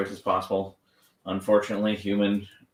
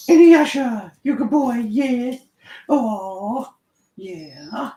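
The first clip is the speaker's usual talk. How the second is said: much higher and louder, gushing over the dog; high excited praise; 13 semitones higher, 8 dB louder, much slower than usual. Real, not acted. Trainer praising her dog Inuyasha in a high, excited gush. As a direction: cheer the pup on in a bright squeal.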